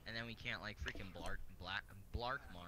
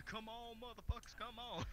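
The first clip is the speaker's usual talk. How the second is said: high mock battle cry; mocking yell; 12 semitones higher, slower than usual. Mocking his friend's fight cry, pitched high and hammed up.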